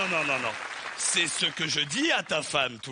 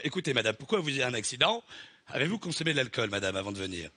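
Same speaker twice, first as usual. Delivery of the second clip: low mechanic voice; 7 semitones lower, faster than usual. Acted. New mechanic character voice.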